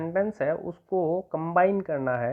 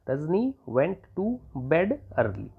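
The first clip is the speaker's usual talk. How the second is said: very slow, word by word, ending on a fall; slow repeat of "Rajni went to bed early"; slow dictation; much slower than usual. Shown as a demonstration. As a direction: repeat it slowly for copying. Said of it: "तो आप लिख देंगे".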